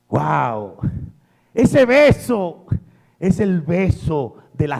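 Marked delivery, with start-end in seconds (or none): none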